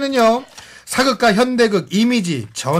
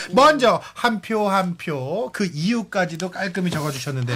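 normal talking voice

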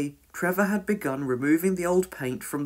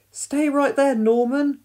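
high, raised rescuer's call; 10 semitones higher, 5 dB louder than usual. A narrator's reading. Firefighter Penny to boy at window, calling out. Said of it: "called Penny".

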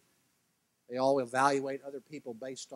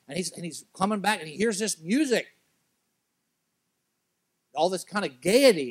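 loud, higher, urgent sermon delivery; full-force preaching; 7 semitones higher, 12 dB louder than usual. Acted. Preacher booming the story to his congregation, loud and high. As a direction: preach it out to the whole room.

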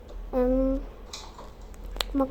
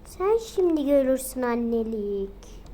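ordinary delivery

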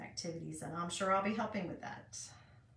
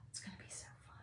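whisper; 17 dB quieter, faster than usual. Real, not acted. Whispering while crafting.

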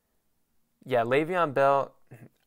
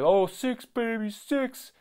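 higher, slow gloating fan voice; mocking Steelers fan; 9 semitones higher, slower than usual. Mocking a gloating Steelers fan, higher slow bragging voice. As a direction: lord it over them like a smug fan. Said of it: "when they're like".

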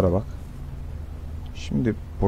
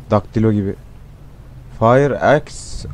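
ordinary delivery

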